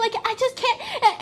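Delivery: very high, laughing mock-meltdown voice; mock helpless frustration.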